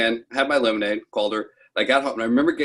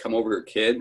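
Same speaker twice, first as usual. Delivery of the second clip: usual speaking voice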